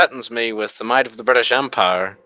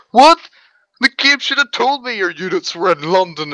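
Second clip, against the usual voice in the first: mock outrage, pitched higher in a put-on voice; indignant friend; 8 semitones higher, 3 dB louder than usual. Mocking his friend's complaint about hidden units, put-on whine. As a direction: play the whiny loser blaming the game. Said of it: "He's like".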